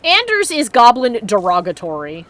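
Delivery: ordinary talk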